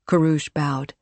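ordinary delivery

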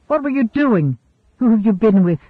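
jealous, forceful interrogation; sharp demand. A narrator's reading. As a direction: demand answers from her. He seizes her arm, demanding answers.

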